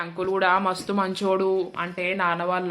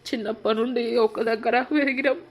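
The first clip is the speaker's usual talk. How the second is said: tearful voice building higher into sobbing thanks; crying; 5 semitones higher than usual. Acted. Brother thanking his sister through building tears. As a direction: voice rising and breaking as the tears build. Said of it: "ఎందుకు ఎడుతున్నావు చెప్పు?".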